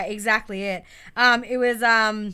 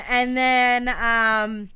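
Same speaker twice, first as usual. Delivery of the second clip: ordinary delivery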